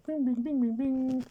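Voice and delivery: very high ringing bing-bing-bing; playful high-pitched rapid pinging; imitated bing. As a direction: chirp out a string of high bings. Imitates a game attack sound.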